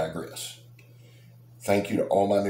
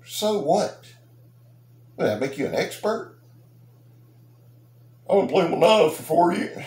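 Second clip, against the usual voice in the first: indignant retort; 10 semitones higher, 4 dB louder than usual. Real, not acted. Indignant rant at reviewer.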